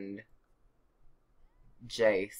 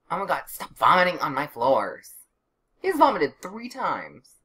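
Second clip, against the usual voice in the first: loud, higher-pitched outburst of dismay; exasperated scolding; 5 semitones higher, 7 dB louder than usual. Real, not acted. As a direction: scold loudly, voice climbing in exasperation. Scolding a vomiting game character.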